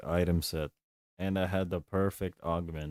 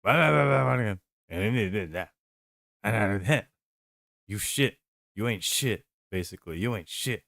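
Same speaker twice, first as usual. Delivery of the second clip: mock Trump rant; 5 dB louder than usual. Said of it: "And then Trump is like".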